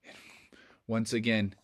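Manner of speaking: ordinary delivery, nothing marked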